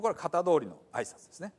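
usual speaking voice

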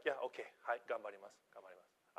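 mumbled, fading mock student; student's offhand Japanese mumble; lazy student reply. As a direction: brush off the teacher half-heartedly, trailing off. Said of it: "he's like".

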